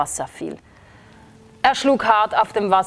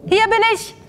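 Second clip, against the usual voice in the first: calling out; 13 semitones higher, faster than usual. A narrator's reading. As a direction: shout up to the thunder. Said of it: "rief der Frosch zurück".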